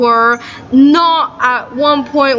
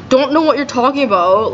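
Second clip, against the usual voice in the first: ordinary delivery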